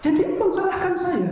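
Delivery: high, loud accusing wife; wife accusing her husband of blaming her, high and loud; angry wife. Retold or imitated. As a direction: throw the blame back at him, high and loud. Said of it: "Dia marah".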